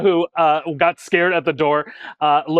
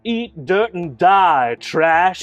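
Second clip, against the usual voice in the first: villain taunt; 2 semitones higher, 5 dB louder, much slower than usual. Acted. GM voices villain's killing line.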